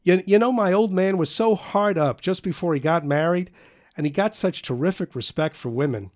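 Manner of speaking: high, loud, scornful tirade; a boy mocking his father to friends; agitated bitter rant. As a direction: spit out contempt for his father. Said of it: "taking the dim and agitated view".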